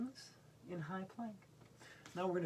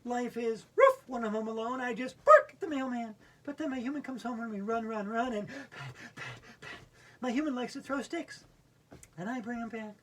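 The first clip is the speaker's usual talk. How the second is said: higher, louder doggy recital; dog reciting a poem; 5 semitones higher, 7 dB louder than usual. Acted. Reciting the Fetch poem as the dog, louder and higher. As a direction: perform the poem as an eager dog. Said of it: "recite Fetch with me".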